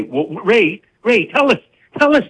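high, pleading eager voice; eager host. Retold or imitated. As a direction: beg him to tell, high and eager. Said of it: "you're gonna say".

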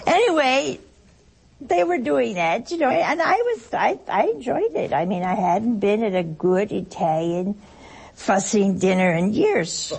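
usual speaking voice

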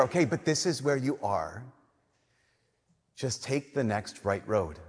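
soft, slow, gentle; calm self-reassurance. Retold or imitated. Inner voice calming a frustrated driver, soft and slow. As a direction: talk yourself down kindly. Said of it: "hearing myself in my head".

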